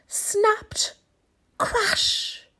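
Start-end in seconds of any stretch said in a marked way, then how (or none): 1.6-2.5 breathy high crash effect; whispered crash sound effect. A narrator's reading. Narrator voices the branch breaking, crash effect. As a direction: sound out the snap.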